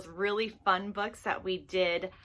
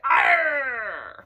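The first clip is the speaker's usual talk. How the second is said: loud pirate arr; hammed-up pirate shout; pirate growl; 7 dB louder than usual. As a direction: bark it like a pirate. Playing pirate for kids.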